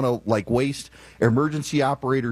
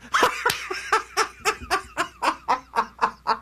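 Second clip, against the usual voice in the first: high-pitched, drawn-out laughing fit; hearty laughter. Real, not acted. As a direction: crack up in a long, high laugh. Cracking up at the AI's joke.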